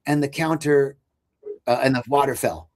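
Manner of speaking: usual speaking voice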